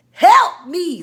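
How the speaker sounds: loud, high plea for rescue; mock desperate cry. Trapped with the friend, loud mock plea for help.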